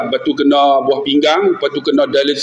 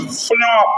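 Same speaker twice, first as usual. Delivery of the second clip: higher-pitched smack noise; imitated whack; 5 semitones higher than usual. Son acting out father's beating, imitated whack. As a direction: mimic a cord hitting skin.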